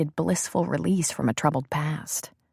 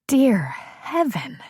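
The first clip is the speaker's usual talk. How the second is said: barely voiced, caught breath; breathless gasp; 6 semitones higher than usual. Woman after lovemaking to her lover, gasping whisper. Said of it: "she gasped".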